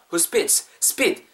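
"spits" punched, "who" left light; stress on SPITS. Shown as a demonstration. Teacher drilling where the stress goes, "spits" punched. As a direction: put the weight on "spits". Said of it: "spit 자체에다 강세를 주세요".

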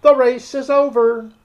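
loud, high call across a crowd. A narrator's reading. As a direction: call it out to everyone. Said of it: "the Dodo suddenly called out".

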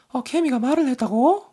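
high put-on woman's voice, loud; female voice. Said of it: "여자 목소리".

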